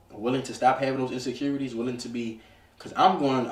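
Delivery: normal talking voice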